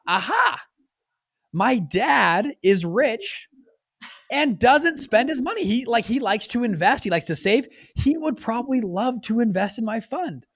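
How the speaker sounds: high, drawn-out sly realization; scheming eureka. Retold or imitated. Son plotting to pitch his rich dad, sly excitement. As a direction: savour the idea like a clever plan. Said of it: "I thought".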